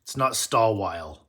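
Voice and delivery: Stawwile with a W sound, no final E; the mispronounced Stawwile; mock wrong way to say it; wrong pronunciation. Shown as a demonstration. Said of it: "This is pronounced with a V sound".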